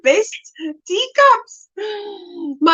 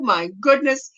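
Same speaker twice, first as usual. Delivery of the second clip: ordinary talk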